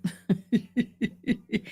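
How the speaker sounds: a small laugh at herself; quiet chuckle at her own joke; soft laugh. Real, not acted. Laughing at crying in wheelchair.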